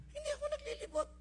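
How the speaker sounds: emphatic denial. Acted. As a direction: insist with a high, fast voice. Pastor insisting he does not roam. (mataas at mabilis na pagtanggi)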